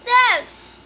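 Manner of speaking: loud high call across the house; yelling for Zeus from afar. Real, not acted. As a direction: shout the dog's name to get him. Calling the dog across room.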